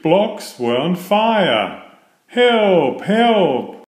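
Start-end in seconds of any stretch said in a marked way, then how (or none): 2.3-3.8 high, loud panicked cries; raised-voice distress call; crying for help. A narrator's reading. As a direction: call out in alarm, each word drawn out. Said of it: "came through the yard crying".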